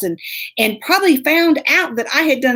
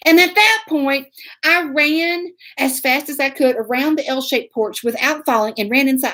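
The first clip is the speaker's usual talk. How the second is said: normal talking voice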